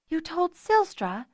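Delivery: high, slow, shocked question; aghast cry. Acted. Fiona reacts to Zener telling Silstra, aghast and high. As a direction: gasp it out in disbelief. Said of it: "Fiona cried, aghast".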